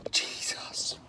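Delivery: whispered gasp of pain. Real, not acted. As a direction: breathe it out unvoiced. Mouth burning from spicy food.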